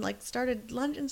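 usual speaking voice

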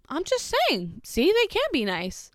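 a light imitation of a smug boy; mocking the kid. Babysitter mimics the boy's smug excuse, whiny and high. Boy defends his remark.

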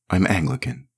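ordinary delivery, nothing marked

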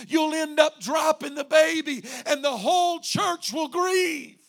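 loud, high, urgent exhortation; full-force preaching. Real, not acted. Pastor warning the church against dropping the baby, full-force preaching. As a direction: thunder it out to the congregation.

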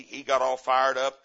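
normal talking voice

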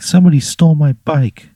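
put-on high, loud upset child voice; upset kid. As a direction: play a distressed little girl. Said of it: "And Moira's like".

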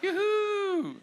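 high, loud laughing yoo-hoo; excited whoop. Real, not acted. Cheering news of first baby.

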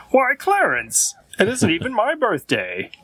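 lightly voiced astonished narrator; cartoon narrator voice. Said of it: "the narrator ... says".

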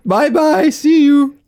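high, laughing bye-bye; sing-song goodbye. Pretend. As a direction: echo the teasing farewell. Echoing the mock goodbye.

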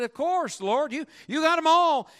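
acted eager assent, high-pitched; eager reply. Acting out Meyer's eager answer.

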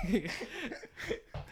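breathy high laugh. Real, not acted. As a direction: burst out laughing. Laughing at own joke.

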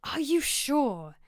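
tentative, drawn-out query; careful. Acted. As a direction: tread carefully as you question him. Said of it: "said Horatio carefully".

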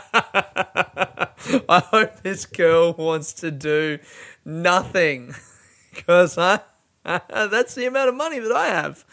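giggling as the words come out; half-laughing at his own joke; laughing through words. Real, not acted. Laughs at own broke date.

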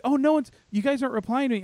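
high, quick imitation of a bubbly woman; bubbly newcomer. Newcomer to a silent meeting, mock bubbly. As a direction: chirp it fast in a mock bubbly voice. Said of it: "way too bubbly".

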